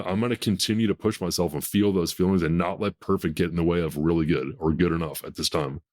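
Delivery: usual speaking voice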